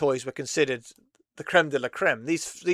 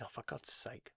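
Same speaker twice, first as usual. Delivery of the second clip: muttered under breath; 4 semitones lower, 23 dB quieter than usual. Real, not acted. Exasperated at search results.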